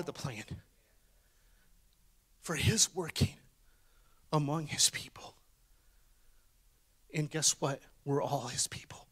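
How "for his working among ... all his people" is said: soft, unvoiced hush; whispered. Real, not acted. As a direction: drop into a hush for the point. Whispered sermon close.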